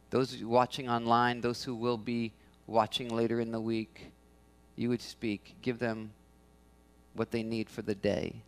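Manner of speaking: soft, low pastoral prayer. Real, not acted. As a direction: pray softly and reverently. Pastor prays over congregation.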